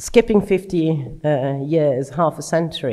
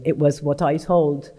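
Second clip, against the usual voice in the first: ordinary talk, nothing marked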